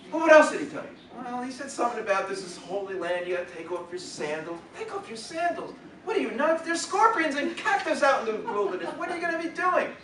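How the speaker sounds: high, scandalised Yiddish mother; Yiddish accent. Acted. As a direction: scold him like a worried mother. Mother scolds about sandals.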